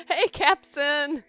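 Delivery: high, loud, drawn-out hello through laughter; laughing greeting. Real, not acted. Streamer greeting a viewer mid-prank, laughing loudly. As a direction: call out slowly while cracking up.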